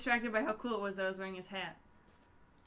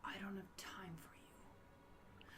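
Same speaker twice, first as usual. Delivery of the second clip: whispered read; 4 semitones lower, 16 dB quieter, slower than usual. Acted. Reading villain's dialogue aloud.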